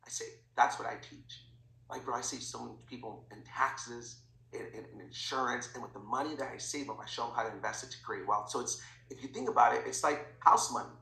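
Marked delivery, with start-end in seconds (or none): 0.5-8.5 hushed, low confidential sales pitch; telling a secret. Shown as a demonstration. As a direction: keep it low and soft, like insider information. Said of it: "Like, I'm telling them a secret".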